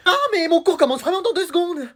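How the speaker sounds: high, softened exclamation; alarmed realisation. Acted. Late child to parents, alarmed realisation.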